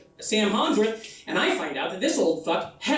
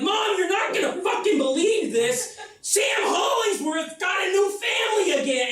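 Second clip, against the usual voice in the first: shouting gossip down the phone; excited shout; 9 semitones higher than usual. Retold or imitated. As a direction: blurt the scandal out to mom. Calling mom with news.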